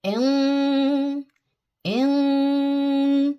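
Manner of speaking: इम hummed light and thin through the nose; light empty-mouth nasal इम; empty-mouth ghunna. Shown as a demonstration. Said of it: "empty mouth गुन्ना करना है".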